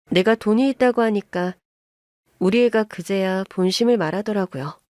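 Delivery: plain reading voice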